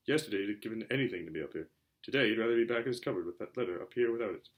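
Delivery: usual speaking voice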